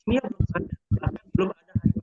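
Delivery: choppy, breaking-up call audio; glitching audio. Real, not acted. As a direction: voice cutting in and out. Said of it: "Pak, kok putus-putus ya".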